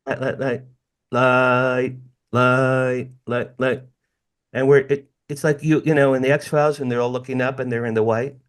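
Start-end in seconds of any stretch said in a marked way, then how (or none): 0.1-3.8 rhythmic spaced repeats of light like a signal; each light as its own pulse; imitating flashing light. Shown as a demonstration. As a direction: tap out light, light like Morse code. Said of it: "And it was like this".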